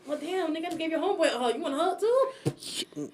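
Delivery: mock exasperation. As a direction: play it put-upon, drawing out the last question. Acting out reply to hug requests.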